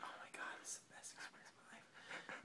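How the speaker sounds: hushed, breathy whisper of wonder; awed whisper. Retold or imitated. Man seeing his first Brontosaurus, awed whisper. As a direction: whisper it like you can't believe it.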